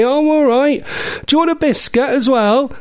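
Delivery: high, sing-out nan voice; doting nanny. Retold or imitated. Nanny offering grandchild a biscuit, high fussing voice. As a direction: fuss over them, pitched right up.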